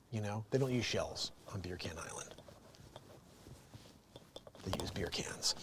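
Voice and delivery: unvoiced whispered aside. Real, not acted. Hushed joke about hermit crabs, whispered. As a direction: whisper the punchline like a secret.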